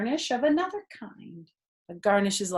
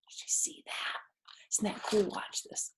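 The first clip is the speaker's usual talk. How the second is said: hushed whisper, sharing a secret; soft conspiratorial whisper; excited whisper; 8 dB quieter, faster than usual. Real, not acted. Secretive aside to children.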